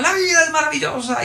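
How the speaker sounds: high, elated mock yell without much volume; imitating shouting teacher. Teacher up on the desk before pupils, imitated high yell. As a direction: mimic a wildly cheerful teacher crying out. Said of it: "se subía en el pupitre y gritaba".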